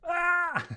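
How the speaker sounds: very high, loud scream; panicked scream. Acted. Whole crew screams as attacker lands, panicked. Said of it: "Everyone going".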